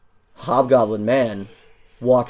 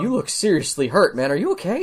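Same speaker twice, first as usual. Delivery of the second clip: surprised concern; 6 semitones higher than usual. Acted. Guard surprised at injury.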